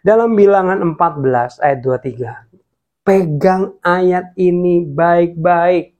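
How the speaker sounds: ordinary delivery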